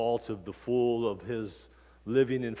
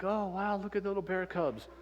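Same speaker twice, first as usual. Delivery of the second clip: high, wide-eyed oh wow; delighted wonder; 9 semitones higher than usual. Retold or imitated. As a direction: play the thrilled dad spotting cubs. Dad marvels at bear cubs.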